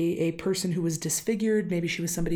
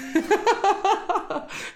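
high burst of laughter; 13 semitones higher than usual. Real, not acted. Laughing at her own accent.

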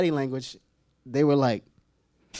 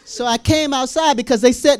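normal talking voice

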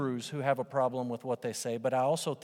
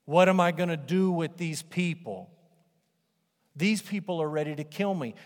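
louder, whiny complaint; a touch louder, whining; complaining Moses; 4 dB louder than usual. Retold or imitated. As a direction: moan about these people. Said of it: "You're like Moses, complaining".

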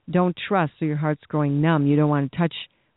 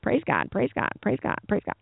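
high, softer parroted praise God; parakeet praise; 5 semitones higher, 4 dB quieter than usual. Retold or imitated. Pastor mocking empty repetitive praise, parakeet-like. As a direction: repeat it like a mindless parakeet. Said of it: "parakeet kind of praise".

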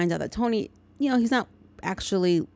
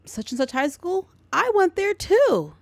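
higher and louder, with glee; a sudden excited rise on the last line; excited manager; 7 semitones higher, 4 dB louder than usual. Retold or imitated. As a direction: plant manager, delighted to find a fellow alum. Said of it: "the plant manager says".

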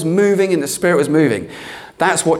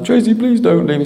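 pleading; 4 semitones higher than usual. Retold or imitated. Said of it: "And I".

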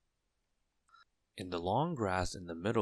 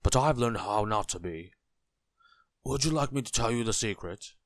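reassuring tramp voice, a little louder; kindly tramp; 3 semitones higher, 5 dB louder than usual. A narrator's reading. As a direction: offer a secret as a friendly vagrant. Kindly tramp offers his secret.

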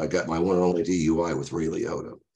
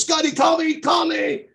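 much higher, loud teasing shout; Ray shouting goodbye; 6 dB louder than usual. Retold or imitated. Friend shouts from departing car, high loud teasing. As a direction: holler it out the window as she drives off. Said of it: "drives off and goes".

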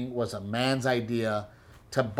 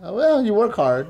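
mock dad reply; 7 semitones higher than usual. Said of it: "That's what he said".

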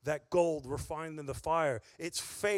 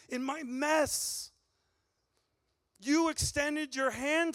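raised, fervent preaching voice, pitch pushed up; impassioned preaching; 8 semitones higher than usual. Acted. Preacher to congregation about God's mercy, impassioned. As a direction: pour it out, building with fervour. Preaching climax, higher and louder.

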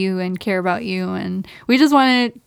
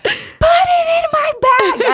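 much higher, slow, laughing half-shout; squealed plea. Retold or imitated. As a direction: beg in a squeaky panicked wail. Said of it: "I was like".

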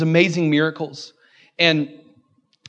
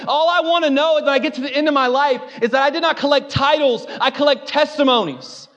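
higher, driving preacher's build; preaching at full force; 9 semitones higher than usual. Real, not acted. Pastor rousing congregation at sermon's climax, full preaching. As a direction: preach it out, rising and insistent.

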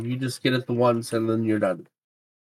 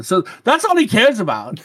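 normal talking voice

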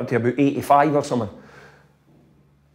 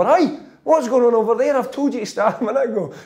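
higher-pitched mock shout across the pitch; retold shout; 6 semitones higher than usual. Player retells manager checking on an idle group, mock shout. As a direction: imitate a manager calling out, pitched up. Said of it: "he's shouting over".

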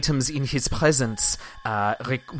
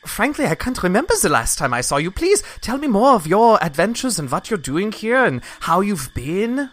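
higher, louder, gushing woman's voice; effusive NPC woman; 7 semitones higher, 4 dB louder than usual. Acted. NPC woman greeting her old friend, gushing higher voice.

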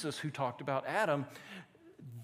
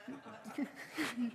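real laugh; 6 semitones higher, 9 dB quieter than usual. Real, not acted. Laughs at own joke.

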